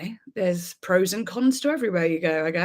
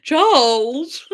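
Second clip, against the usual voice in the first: mock call; 4 semitones higher, 8 dB louder than usual. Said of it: "she's called Charles".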